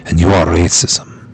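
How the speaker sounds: put-on low, dramatic telenovela Mexican accent, drifting French; hammed-up, low Mexican accent. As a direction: play a low-voiced Latin soap star with a bad accent. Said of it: "trying to do my telenovela".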